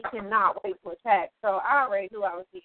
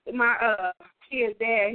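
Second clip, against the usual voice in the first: normal talking voice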